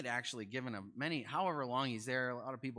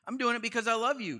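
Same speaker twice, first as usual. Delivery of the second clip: impassioned Paul; 10 semitones higher, 8 dB louder than usual. Retold or imitated. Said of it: "He says".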